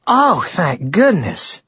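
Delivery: high, drawn-out delighted exclamation; happily relieved, stretching the words; delighted relief. A narrator's reading. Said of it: "she said, delighted".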